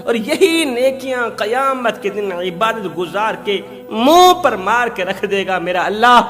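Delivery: ordinary talk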